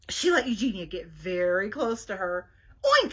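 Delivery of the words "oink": a much higher, louder cheeky oink; playful oink. Acted. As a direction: tease her pursuer. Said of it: "said Mercy".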